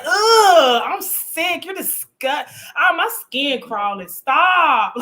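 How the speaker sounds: high, loud hammed-up revulsion through laughter; mock disgust. Co-host mimicking the husband she laughed at, mock disgust. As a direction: imitate him being grossed out, laughing.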